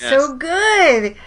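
loud, high, delighted gush. Real, not acted. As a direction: savour it like a treat. Gushing over actress.

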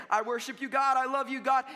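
mock gushing worshipper. Mocking hollow worship.

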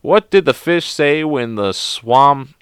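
normal talking voice

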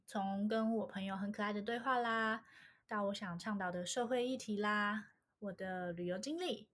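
ordinary talk

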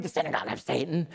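quick, hammed-up rasp; raspy villain reading. Shown as a demonstration. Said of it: "And so I would read".

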